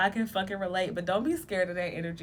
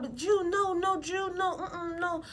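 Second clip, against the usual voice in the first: higher, hammed-up denial; mock protesting listener; 10 semitones higher than usual. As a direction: play an indignant skeptic, higher. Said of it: "you can be like".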